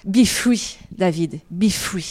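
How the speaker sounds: usual speaking voice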